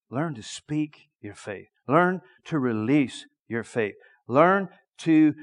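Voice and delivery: ordinary talk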